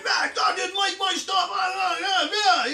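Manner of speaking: high, loud hammed-up yell of fury; raging shout. Parent acting out Cain shouting at God, hammed-up furious yell. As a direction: bellow it like a furious, jealous brother. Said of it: "he's really mad".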